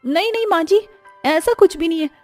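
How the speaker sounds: high, loud desperate denial; frightened pleading. Acted. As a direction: beg her to believe you, voice up high. Bride pleading after accusation. (घबराई हुई, ऊँची आवाज़ में गिड़गिड़ाना)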